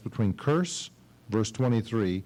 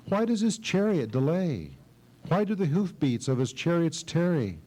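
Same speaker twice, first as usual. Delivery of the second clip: higher, worried mother reading lines; mother waiting for her son, higher and worried; anxious mother; 6 semitones higher than usual. Acted. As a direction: lightly voice a fretting mother at the window.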